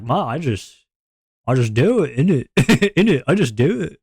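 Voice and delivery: hammed-up British imitation with a chuckle; mock old British accent. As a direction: play a simple old Brit, swinging the pitch. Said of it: "why'd you become old British".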